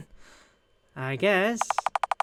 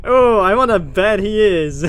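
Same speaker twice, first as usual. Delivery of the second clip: slow, much louder mocking jab; teasing; 3 semitones higher, 10 dB louder than usual. Friend teasing Oscar about being submissive, loud and mocking. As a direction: drag it out to needle him.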